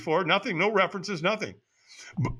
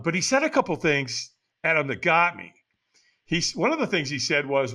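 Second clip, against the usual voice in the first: usual speaking voice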